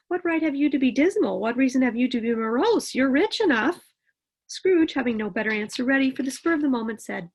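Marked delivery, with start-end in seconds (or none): 0.1-3.9 higher, louder playful retort; gaily. Acted. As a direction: tease him back with cheer. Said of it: "returned the nephew gaily".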